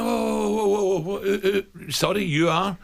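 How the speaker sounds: high startled whoa. Retold or imitated. Startled reply to angry boss.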